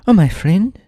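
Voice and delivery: high-pitched, mock-grave boy; Tip's grave high voice. A narrator's reading. Tip gravely breaking news to Jack, high mock-grave boy voice. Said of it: "said Tip gravely".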